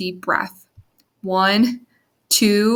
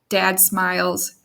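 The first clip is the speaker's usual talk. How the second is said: ordinary delivery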